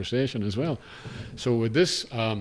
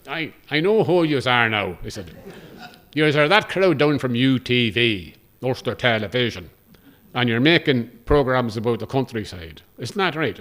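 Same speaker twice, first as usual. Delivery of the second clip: put-on lively Cavan taxi driver's accent, a touch louder; 2 semitones higher, 7 dB louder than usual. Taxi driver greeting the TV crew, in a Cavan accent. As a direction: Cavan country accent, chatty and pleased. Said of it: "I'm gonna attempt a Cavan accent".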